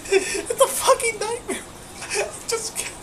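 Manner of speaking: high-pitched laugh-crying through the words; half laughing, half sobbing in despair. Real, not acted. Breaking down laughing at clip.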